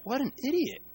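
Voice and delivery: a hint of fed-up disbelief, said lightly; exasperated scoff. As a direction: scoff at someone who can't see it. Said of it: "we've been like".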